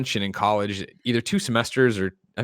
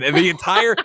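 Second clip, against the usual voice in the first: ordinary talk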